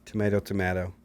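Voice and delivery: "tomato" first as to-MAY-to, then to-MA-to; two contrasted pronunciations. Shown as a demonstration. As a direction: switch the middle vowel on the second "tomato". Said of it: "when so people say".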